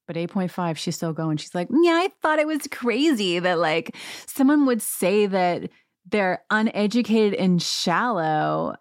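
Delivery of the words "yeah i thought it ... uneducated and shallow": high, drawn-out mock housewife; lightly mocked voice, slow and high; mocking housewife impression. Recapper mimics housewife at dinner table, mocking.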